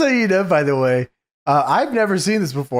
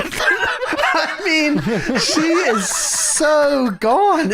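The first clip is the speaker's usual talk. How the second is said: high, slow words through laughter; laughing while talking about Tammy; 9 semitones higher, much slower than usual. Real, not acted. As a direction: laugh it out in disbelief. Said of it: "It's actually nuts looking at her".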